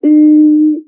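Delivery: Ü as ee through tightly rounded lips, loud and held; whistle-lipped ü. Shown as a demonstration. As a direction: hold Ü with lips set to whistle. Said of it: "like when you're doing whistling".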